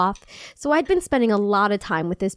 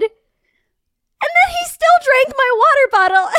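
exasperated; 6 dB louder, slower than usual. Real, not acted. Venting about boyfriend drinking her water.

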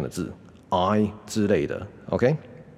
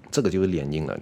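ordinary talk

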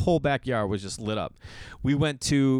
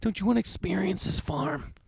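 pleading dad; 9 semitones higher than usual. Retold or imitated. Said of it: "I'm like".

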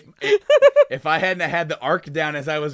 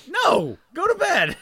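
high, louder parent refusal; firm high no; 6 dB louder, slower than usual. Retold or imitated. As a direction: shut the kid down firmly. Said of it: "And it's like".

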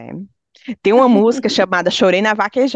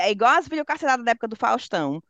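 ordinary talk

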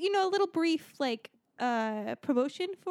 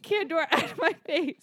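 mocking quote; 5 semitones higher, 4 dB louder, slower than usual. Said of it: "sentence was".

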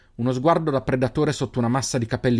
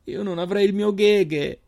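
high, slow and cracking with tears; voice broken by tears; 10 semitones higher, 3 dB louder, slower than usual. A narrator's reading. Sister crying out to her brother, voice breaking. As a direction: cry it out, voice breaking. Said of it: "La voce rotta dalle lacrime".

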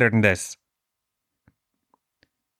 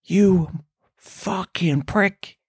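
slow, drawn-out furious curse under the breath; seething curse; 5 semitones higher, much slower than usual. Retold or imitated. At the man stealing his popcorn, slow seething curse. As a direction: spit it out slowly, barely holding the rage. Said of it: "I'm like".

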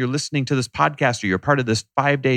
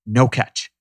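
usual speaking voice

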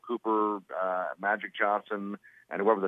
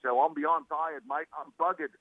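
tired Australian; 6 semitones higher, slower than usual. Retold or imitated. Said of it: "He goes".

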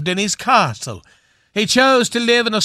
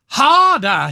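a higher, louder hammed-up cry of Harder!; joke-teller hamming up the wife's cry; mock cry; 6 semitones higher, 4 dB louder than usual. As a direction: urge him on with a mock shout. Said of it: "she cried".

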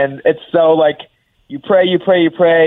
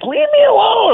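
mock protest in a high squeal; high-pitched playful whine of complaint; imitating girlfriend. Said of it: "She's like".

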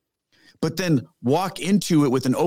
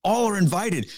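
usual speaking voice

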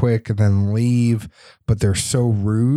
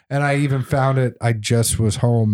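normal talking voice

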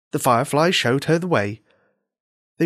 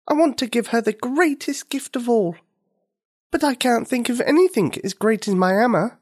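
put-on high little girl voice with a touch of sadness; a child pouring her heart out to a firefly; little girl, sad; 10 semitones higher than usual. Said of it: "said Nikini sadly".

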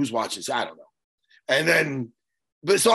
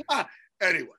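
ordinary talk, nothing marked

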